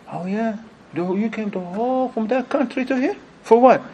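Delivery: high-pitched questioning, starting soft and rising in force; mock amazement. Brother quizzing a travelling preacher on his trip, mock amazement. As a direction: act amazed, then demand the reason.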